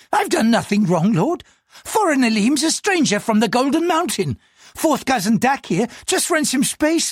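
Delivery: high, anxious pleading voice; a frightened man answering the police; nervous clerk. Acted. Nervous clerk answers the superintendent in a high, anxious voice.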